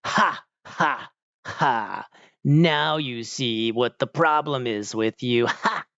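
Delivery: hammed-up bad actor with forced, separate ha-has; cheesy villain acting; fake laughing villain. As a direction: deliver it slowly with a staged fake laugh. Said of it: "like, the fake laughing".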